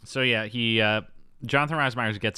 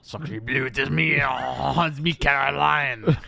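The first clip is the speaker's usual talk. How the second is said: hammed-up French accent, rising louder; over-the-top French accent building in volume; bad French accent; 4 semitones higher, 4 dB louder than usual. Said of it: "I'm trying to do a French accent".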